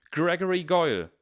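high, loud call of the name; shouting. A narrator's reading. Harry calls out Goyle before everyone, shouting. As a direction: shout the name across a crowd. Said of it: "schrie Harry".

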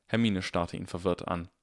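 ordinary delivery

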